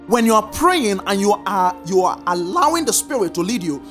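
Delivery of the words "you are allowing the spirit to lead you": normal talking voice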